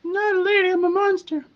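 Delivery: very high little monster voice; squeaky voice claiming to be a monster; high-pitched monster voice. Acted. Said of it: "that's the voice you hear".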